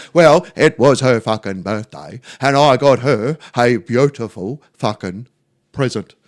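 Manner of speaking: a hammed-up, deadpan Terry. Neighbour Terry explaining his girlfriend's mood, slow dopey voice. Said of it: "And he goes".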